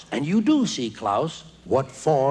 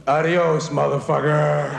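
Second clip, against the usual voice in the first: tough-guy goodbye. Retold or imitated. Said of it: "the American version".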